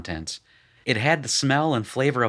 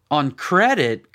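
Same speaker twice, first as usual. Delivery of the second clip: slow, loud, higher cry; outraged cry; 6 semitones higher, 7 dB louder, slower than usual. A narrator's reading. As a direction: cry it out indignantly. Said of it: "cried out Shirkahel".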